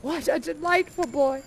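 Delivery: ordinary delivery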